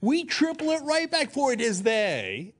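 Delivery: Sarah Palin impression. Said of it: "a voice that is not Sarah Palin's".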